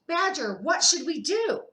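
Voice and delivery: high, loud anxious plea; pleading worry. Acted. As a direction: beg the badger for help. Hedgehog pleading to badger.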